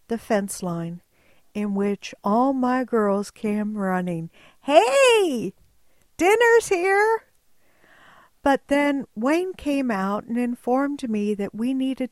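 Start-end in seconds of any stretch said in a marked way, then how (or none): 4.6-7.2 high, loud sheep yell; sheep calling. Retold or imitated. Her sheep running up to the hay trailer, high and loud. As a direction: call out like an eager sheep.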